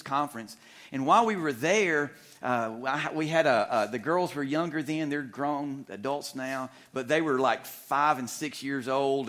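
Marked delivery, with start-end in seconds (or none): none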